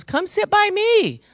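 high, drawn-out sweet invitation; mild inviting lady voice. Retold or imitated. Lady across the room calls her over, high and sweet. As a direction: coax her over in a lilting voice.

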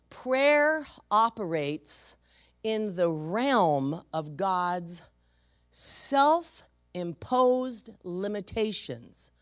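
very slow, each word spaced; preacher stressing his key point; slow emphasis. Real, not acted. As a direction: spell the point out slowly. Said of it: "Very important for you to know that".

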